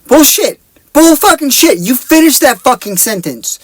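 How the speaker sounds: high-pitched, exasperated outrage; indignant outburst. Real, not acted. Reviewer scolding the movie character for not finishing his sentence, indignant. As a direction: call it out in high-pitched disbelief.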